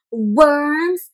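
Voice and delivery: ordinary talk, nothing marked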